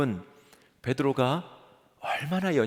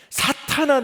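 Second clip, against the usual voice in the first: "사탄아" shouted much higher and louder; stern rebuke; 13 semitones higher, 7 dB louder, slower than usual. Acted. Jesus rebuking Peter, a sharp high shout. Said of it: "주님이 베드로에게 이렇게 말씀하시죠".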